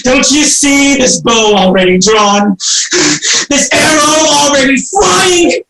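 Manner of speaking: high, shouting defiance with sobs; raving threats. Acted. Orestes threatening the Furies with his bow, raving shout. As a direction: drive the demons off in fury.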